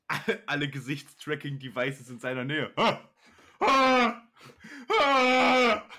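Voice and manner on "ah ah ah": laughing mock screams, louder each time. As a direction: scream in fake horror while laughing.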